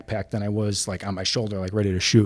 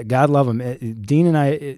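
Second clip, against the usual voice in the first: ordinary talk, nothing marked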